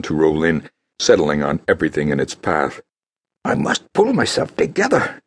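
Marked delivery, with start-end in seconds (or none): 3.4-5.2 high, resolute inner voice; purposeful thought. Acted. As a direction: steel herself through the drink. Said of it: "Dark Annie thought with purposefulness".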